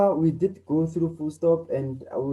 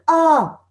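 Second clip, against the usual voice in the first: sudden high, loud pain cry; 12 semitones higher, 10 dB louder than usual. Shown as a demonstration. Teacher demoing the cry of stepping on a thumbtack. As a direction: yelp like you stepped on a tack. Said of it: "because you'll be feeling pain".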